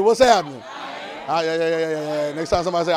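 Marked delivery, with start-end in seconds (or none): 0.0-0.6 even louder shout after getting no answer; louder call. Shown as a demonstration. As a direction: push the crowd harder, louder. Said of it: "I can't hear you".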